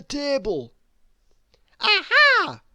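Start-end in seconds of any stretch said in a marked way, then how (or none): 1.8-2.6 very high, loud triumphant aha; triumphant cry. A narrator's reading. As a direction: catch them red-handed from on high. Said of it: "cried Miss Bossy".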